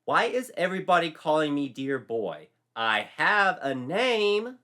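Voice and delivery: high, loud and fed up; exasperated protest. A narrator's reading. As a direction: complain loudly.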